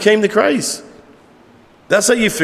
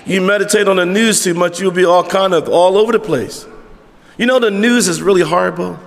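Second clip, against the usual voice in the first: usual speaking voice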